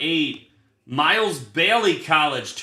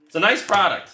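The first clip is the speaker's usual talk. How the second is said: higher and louder, selling it; louder, higher enthusiastic praise of the cards; upbeat praise; 5 semitones higher, 4 dB louder, faster than usual. Real, not acted. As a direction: say it like a hype pitch. Repeats line, first take marked.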